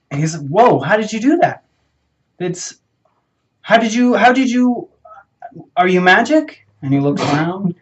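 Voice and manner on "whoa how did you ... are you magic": high, loud, stammering astonishment; acted amazement. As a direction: blurt questions in wide-eyed wonder. Story character amazed by magic canister.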